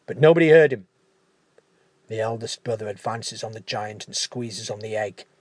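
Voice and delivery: usual speaking voice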